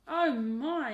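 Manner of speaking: loud, high gasp of surprise; aunt startled by a giant egg, exclaiming; surprised aunt. A narrator's reading. Aunt startled by a giant egg, loud high exclaim. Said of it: "Auntie Elsie said".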